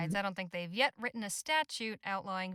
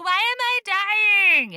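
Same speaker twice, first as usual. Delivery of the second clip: mock complaining shout; 12 semitones higher, 13 dB louder than usual. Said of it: "Every year I'm like".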